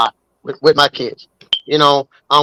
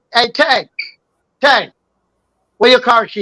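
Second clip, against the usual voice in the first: calling across the house, higher; 7 semitones higher than usual. Real, not acted. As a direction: call out to someone in another room.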